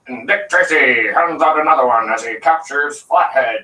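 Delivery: put-on slow old newsreel announcer; old-timey newscaster. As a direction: boom it like a forties newsreel. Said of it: "old-timey newscasters".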